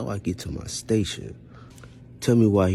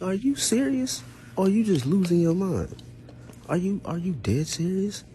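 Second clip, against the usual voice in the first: high, stunned disbelief; can't believe what you just heard; pitched-up shocked questioning; incredulous; 14 semitones higher than usual. Retold or imitated. Reacting to being fired.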